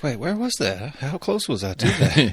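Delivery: high-pitched retold double take; mock alarm. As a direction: react with a startled, slightly hammed-up question. Retelling own startled reaction while reading.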